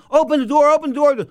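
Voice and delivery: very high, loud repeated demand; demanding yell. Retold or imitated. Host banging on fighter's penthouse door, shouting. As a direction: yell through a locked door.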